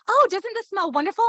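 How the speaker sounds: high, gushing mock delight; imitated delight. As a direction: gush as if imitating someone thrilled. Imitating others delighted by a smell.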